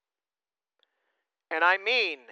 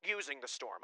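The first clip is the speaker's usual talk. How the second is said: ordinary talk, nothing marked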